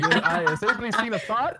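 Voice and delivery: high, loud imitation German accent; thick German accent. Pizza guy calling for Thor over the mic, mock German accent. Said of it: "in a very thick German accent".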